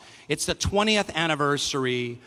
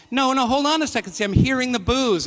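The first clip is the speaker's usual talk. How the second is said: higher, quick objection to the crowd; protesting over boos; 7 semitones higher, 4 dB louder than usual. Real, not acted. Host objects to the crowd's boos, higher protest. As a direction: cut in over the booing, pitched up.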